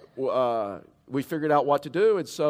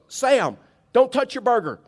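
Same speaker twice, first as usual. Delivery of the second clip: higher and louder, calling out to a diner two tables over; calling across the room; 9 semitones higher, 6 dB louder than usual. Retold or imitated. Waitress calls across to Sam before he eats, loud and high. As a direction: shout the warning across the restaurant.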